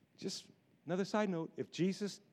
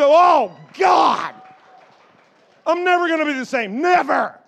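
higher, loud, overwhelmed cry; awed shout; 8 semitones higher, 11 dB louder than usual. Retold or imitated. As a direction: shout it like your life just changed. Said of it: "I think".

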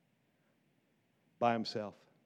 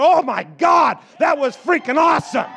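higher, loud, thrilled outburst; excited shout; 7 semitones higher, 11 dB louder than usual. Retold or imitated. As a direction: yell it like you can't contain it. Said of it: "I think that".

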